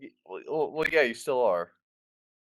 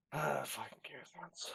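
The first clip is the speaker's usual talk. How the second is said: muttered; 5 semitones higher, 13 dB quieter than usual. Real, not acted. Exasperated aside about the books.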